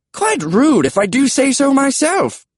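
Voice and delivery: very high British pterodactyl; high-pitched British character voice; British accent. A narrator's reading. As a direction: huff it as a British dinosaur. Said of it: "British accents were unexpected".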